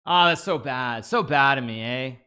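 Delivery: a hint of a Canadian accent; half-accidental Canadian-accented "so bad of me, eh". Real, not acted. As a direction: slip into a light Canadian lilt. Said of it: "Why am I talking like a Canadian?".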